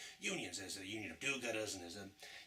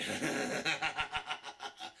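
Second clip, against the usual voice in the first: villain chuckle; 3 semitones higher than usual. Acted. Villain laughs at hero.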